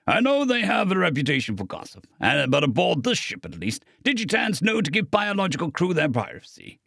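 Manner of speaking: ordinary delivery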